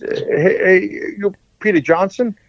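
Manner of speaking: put-on American accent. Retelling a late-night call from an American coach, lightly mock American accent. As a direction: mimic a casual American caller's accent. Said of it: "With the American accent".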